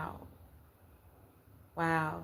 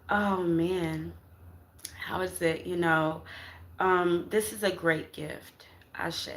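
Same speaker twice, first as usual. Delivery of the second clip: usual speaking voice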